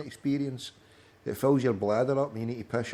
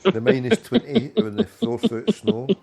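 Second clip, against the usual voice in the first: laughing; 9 semitones higher, 8 dB louder than usual. Real, not acted. Mocking friend over the beers.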